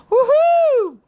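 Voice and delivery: high, loud whoop of joy; excited cheer. Real, not acted. Cheering the New Year for subscribers, a big high whoop. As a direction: let out a big celebratory whoop.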